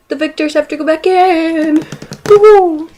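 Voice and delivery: building to a loud, high woohoo; gleeful cheer. Pretend. Gleeful about Hunger Games twist.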